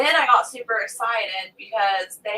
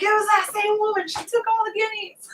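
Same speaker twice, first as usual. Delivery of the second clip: upset husband; 11 semitones higher than usual. Retold or imitated. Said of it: "He was like".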